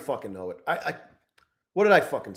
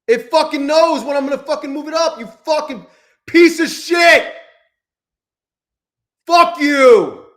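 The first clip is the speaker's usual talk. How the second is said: higher, louder angry yelling; furious shout; 9 semitones higher, 4 dB louder than usual. Real, not acted. Trader raging at his stopped-out trade, furious yelling. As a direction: curse the chart at full force.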